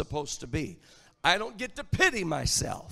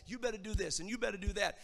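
ordinary talk

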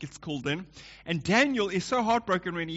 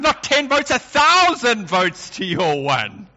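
higher, louder and slowed for effect; mock grand dad; 4 semitones higher, 7 dB louder, slower than usual. Dad teasing his kids about votes, grandly slowed. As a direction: declare it like a playful tyrant king. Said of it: "I said".

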